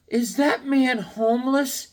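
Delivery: put-on high, slow child voice; hushed kid asking his mom about a stranger; kid's innocent question. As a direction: ask it like a small curious kid. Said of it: "he goes".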